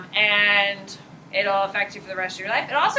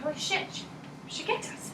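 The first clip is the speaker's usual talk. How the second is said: hushed, breathless whisper of alarm; whispered neuron panic; 5 semitones higher, 13 dB quieter than usual. Pretend. Teacher voicing her own neurons, alarmed hushed whisper. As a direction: whisper it like a shocked little neuron. Inner neurons voiced as characters.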